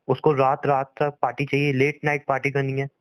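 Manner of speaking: ordinary talk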